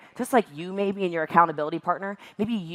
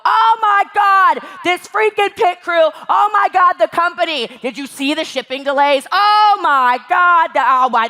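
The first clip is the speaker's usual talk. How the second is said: mock a frustrated complainer, much higher and louder; mock exasperated whining; 9 semitones higher, 5 dB louder than usual. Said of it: "would go".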